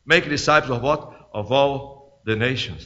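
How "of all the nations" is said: "all the nations" low, soft and slow; hushed answer. Real, not acted. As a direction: drop right down on "all the nations". Hushed answer to own question.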